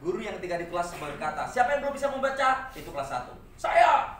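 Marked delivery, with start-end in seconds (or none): 1.5-2.7 high, loud call to the class; loud teacher question. Retold or imitated. Teacher asks class loudly. 3.6-4.2 put-on high, loud child's shout; kids shouting.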